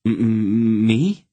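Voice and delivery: a frightened stammer, rising at the end; stammering fright. A narrator's reading. Boy stammers in fear at the countess. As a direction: stutter it out, knees shaking. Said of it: "his knees beginning to tremble".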